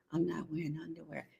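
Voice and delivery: soft, quick whisper into his ear; sultry whispered confession. Shown as a demonstration. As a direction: breathe it fast and secret, very soft. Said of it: "you whisper in his ear".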